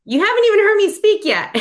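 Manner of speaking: high, loud imitated protest; mock incredulity. Retold sponsor's surprised objection.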